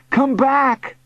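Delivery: high, urgent call upward; shouting. A narrator's reading. Boy to uncle in the sky, shouting. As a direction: shout after someone drifting away. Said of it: "I shouted".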